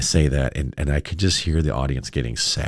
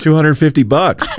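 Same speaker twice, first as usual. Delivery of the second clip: laughing mock quote; 12 semitones higher, 7 dB louder than usual. Mocking mom's line while laughing.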